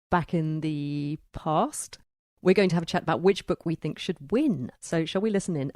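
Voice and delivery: ordinary talk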